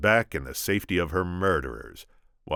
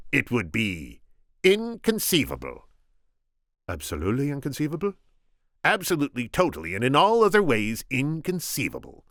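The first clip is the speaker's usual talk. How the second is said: higher, emphatic insistence on inconceivable; reassuring; 9 semitones higher, 3 dB louder than usual. Acted. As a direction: dismiss the doubt grandly. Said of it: "the Sicilian reassured him".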